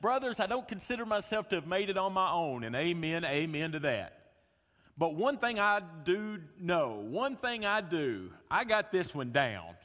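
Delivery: loud, high, fervent preaching; full-voiced pulpit delivery; preaching at full force. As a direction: preach it out to the whole room. Opening sermon at full force.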